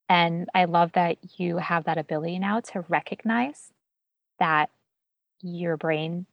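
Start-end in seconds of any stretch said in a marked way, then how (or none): none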